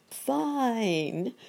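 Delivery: high, delighted exclamation; high-pitched, drawn-out cheer; happier cry. A narrator's reading. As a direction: cry it out with relief as the monkey. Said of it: "feeling happier now".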